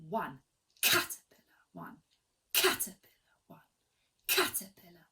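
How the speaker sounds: caterpillar with a punched start, then fading away. Shown as a demonstration. Teacher demonstrating the accent for students, punchy start. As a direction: hit the start of caterpillar and let it go. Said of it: "So we're gonna go like this".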